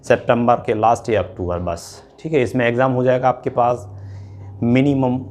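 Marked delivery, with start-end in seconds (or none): none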